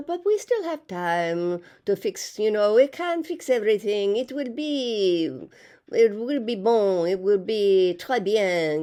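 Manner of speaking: imitating a French oceanographer's accent, unpolished; lightly hammed French accent slipping into French words; mock French accent. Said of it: "Jacques with his French accent".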